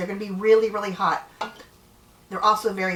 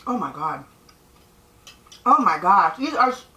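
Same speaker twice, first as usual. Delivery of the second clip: mouth full. Real, not acted. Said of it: "I don't usually talk with my mouth full".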